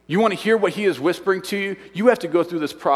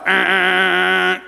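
insistent, even air-horn blasts; air horn honks; 4 dB louder, much slower than usual. Retold or imitated. Camp counselor demonstrating the drowning alarm, imitated air horn. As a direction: imitate an air horn in a held, repeated honk. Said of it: "They get out the air horn".

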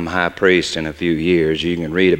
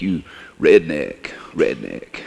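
lower, softer sneer of a snob; sneering priest; 5 semitones lower, 4 dB quieter, slower than usual. Retold or imitated. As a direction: mimic the priest looking down on him. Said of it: "Or just plain old redneck".

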